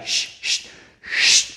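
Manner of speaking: long repeated "shh" blown out; voiceless hiss of shushing; shushing sound. Shown as a demonstration. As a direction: shush rhythmically for a child to copy. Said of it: "el niño te tiene que ayudar".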